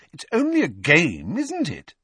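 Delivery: high, exasperated challenge; impatient girl, pitched well up. A narrator's reading. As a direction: push the point, ending on a high swoop. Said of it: "she said impatiently".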